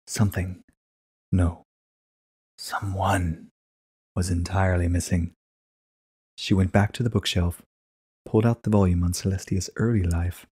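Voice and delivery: plain reading voice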